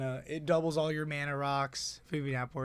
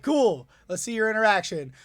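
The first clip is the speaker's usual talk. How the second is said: higher, louder, daring them; cocky taunt; 6 semitones higher, 8 dB louder than usual. Retold or imitated. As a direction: dare them to stop you. Retold taunt at opponent.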